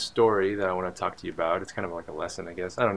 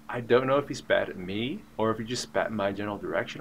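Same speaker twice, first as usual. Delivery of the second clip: ordinary talk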